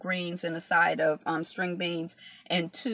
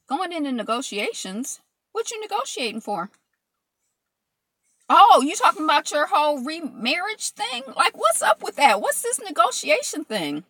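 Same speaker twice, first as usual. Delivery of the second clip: excited teasing; 8 semitones higher, 4 dB louder than usual. Real, not acted. Teasing a viewer in chat.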